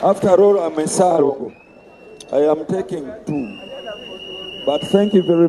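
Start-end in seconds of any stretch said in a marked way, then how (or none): none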